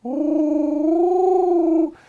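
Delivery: high silly coo; a goofy high ooh; Dumbo ears noise. Pretend. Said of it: "Dumbo ears going, you know, like".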